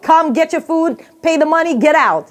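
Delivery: high, brisk mock orders; impatient takeout worker. Takeout worker hurrying a dawdling customer, mock impatience. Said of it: "that's what they're saying".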